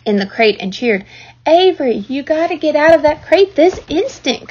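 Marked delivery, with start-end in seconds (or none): 1.5-4.5 high, loud scolding order; exasperated, raised-voice command; commanding mother. A narrator's reading. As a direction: order a child out firmly. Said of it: "commanded his mother".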